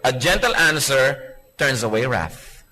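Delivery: slow, measured recitation; scripture reading. Acted. Preacher reciting the Bible verse slowly to the congregation.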